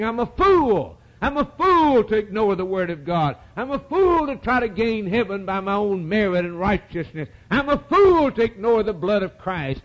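high, preached self-rebuke; acted anger at oneself, pitched high; angry self-reproach. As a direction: voice a man berating himself. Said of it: "growing angry with themselves and saying".

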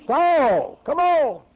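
high loud urging; preacher voicing Christ coaxing Saul, high and loud; coaxing call. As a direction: urge him to come on.

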